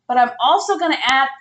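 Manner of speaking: usual speaking voice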